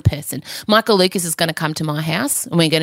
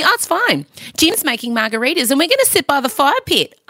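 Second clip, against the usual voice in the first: put-on breezy teen; 9 semitones higher than usual. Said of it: "she said to me".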